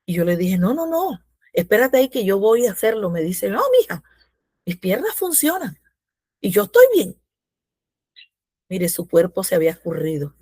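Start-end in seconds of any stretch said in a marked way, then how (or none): 0.6-3.1 high-pitched, insistent objection; retold protest. Daughter stopping her mother from making breakfast, high insistent protest. 3.5-7.2 much higher-pitched retold reassurance; imitated insistent mother. Recovered mother telling her daughter she is fine, high insistent imitation.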